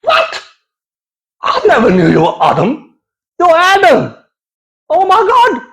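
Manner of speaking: high, astonished disbelief; squeaky stunned disbelief; shocked. Retold or imitated. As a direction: gasp out the shock of the name. Shocked reaction to Adam name.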